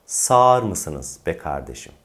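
"Sağır" as one long saar; slow demonstration with "Sağır" merged to saar; correct spoken form. Shown as a demonstration. Teacher models the driver's question, "Sağır" as saar. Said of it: "O işte bakın".

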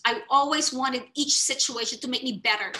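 ordinary talk

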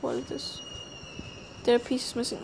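ordinary delivery

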